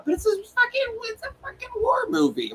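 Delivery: defending the war movie score, high-pitched and incredulous; incredulous protest. Real, not acted. Friend argues the score is fine.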